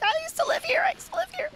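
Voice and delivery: high-pitched excited chant; squealing it like a kid. Retold or imitated.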